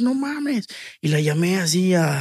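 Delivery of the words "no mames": high, squealing disbelief; joyful shriek. Retold or imitated. Rapper reliving the moment he got into the festival, squealing. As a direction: squeal it out like you can't believe it. Retold scream of joy.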